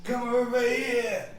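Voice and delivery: high, drawn-out shout to the sky; game master's big booming call; booming yell. A narrator's reading. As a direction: holler it slowly across a great distance. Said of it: "yelling at the dragon".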